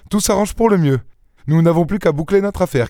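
high, lilting delighted voice. A narrator's reading. Daspry telling his comrade all is arranged, delighted. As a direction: sound thrilled that all is working out. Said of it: "s'écria Daspry d'un air enchanté".

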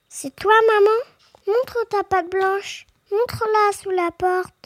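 high little goat voices; little kids' voice. A narrator's reading. Kids asking their mother to show her paw, high little voices. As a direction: ask in a small, high child-like voice. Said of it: "crie les biquets".

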